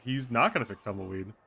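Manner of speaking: usual speaking voice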